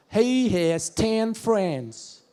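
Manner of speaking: very slow, drawn-out Alabama drawl; Alabama accent. Shown as a demonstration. Comedian demonstrating Southern speech to audience, Alabama accent. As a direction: stretch every vowel, Southern Alabama style. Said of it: "a little bit of Alabama".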